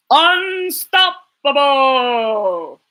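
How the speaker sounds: slow, drawn-out announcer voice; dramatic title. Acted. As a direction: stretch the title out big and slow.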